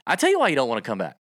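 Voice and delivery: ordinary talk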